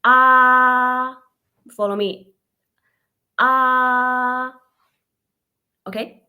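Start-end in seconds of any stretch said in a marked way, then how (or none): 0.0-1.2 AH held flat and straight on a steady note; first tone, flat. Shown as a demonstration. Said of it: "it's flat and straight". 3.4-4.5 AH held flat and straight on a steady note; first tone, flat. Shown as a demonstration. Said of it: "it's flat and straight".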